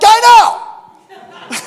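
very high, loud mock fury; exasperated angry yell. Client told "that's anger", mock exasperated shout. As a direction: yell back at the counselor, hammed up. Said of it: "That's anger".

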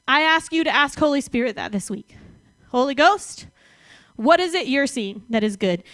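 usual speaking voice

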